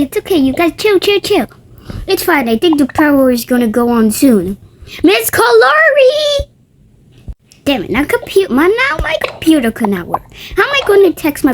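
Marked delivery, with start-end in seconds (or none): 5.0-6.5 high, slow call for the teacher. Acted. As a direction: shout across the room for her. Child calling the teacher.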